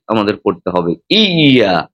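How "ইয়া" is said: ইয়া with a hard push on the doubled ya. Shown as a demonstration. Imam showing the correct ইয়া with a firm push. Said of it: "একটি ধাক্কা দিয়ে তাসদিদটা উচ্চারণ করে".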